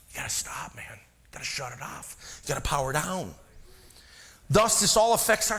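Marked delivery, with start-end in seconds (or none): 0.1-3.3 much softer, whispered plea to stop; hushed urging. Acted. Preacher telling tired churchgoers to stop, whispered softly. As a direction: whisper it like God calming a tired soul.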